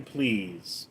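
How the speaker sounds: normal talking voice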